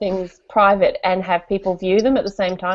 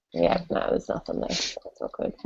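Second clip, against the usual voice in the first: near-whisper, much softer; quiet aside; 10 dB quieter than usual. Real, not acted. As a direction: mutter it to yourself under breath. Muttering checking comments.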